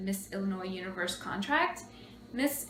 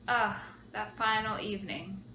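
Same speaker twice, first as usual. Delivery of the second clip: ordinary talk, nothing marked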